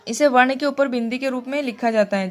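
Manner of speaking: usual speaking voice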